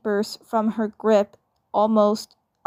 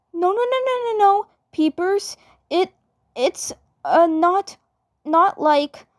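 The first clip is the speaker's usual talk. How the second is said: put-on high, stammering girl voice caught out; a flustered twin sister protesting too much; flustered Mabel; 9 semitones higher than usual. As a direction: stumble over a panicked denial, pitched up. Mabel panicked denial.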